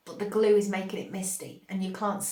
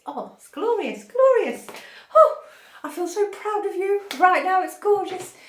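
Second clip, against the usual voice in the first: soaring high and loud, bursting with pride; ecstatic; 10 semitones higher, 8 dB louder than usual. Real, not acted. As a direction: let it burst out, much higher and louder. Ecstatic over viewers' chosen path.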